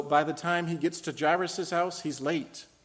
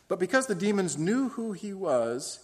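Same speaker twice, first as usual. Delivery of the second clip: usual speaking voice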